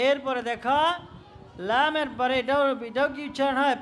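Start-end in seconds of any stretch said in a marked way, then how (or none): none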